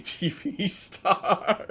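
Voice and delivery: breaking into real laughter, pitch climbing; slow, drawn-out words dissolving into laughter; laughing. Real, not acted. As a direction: crack up while getting the words out. Cracking up at his own absurdity.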